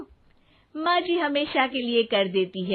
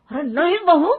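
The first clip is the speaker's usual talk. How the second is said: usual speaking voice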